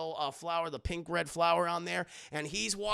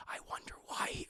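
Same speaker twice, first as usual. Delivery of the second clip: barely audible whisper; softer whisper; 11 dB quieter than usual. Real, not acted. Repeating the jab to chat, almost silent. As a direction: hush it even further. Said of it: "unvoiced whisper".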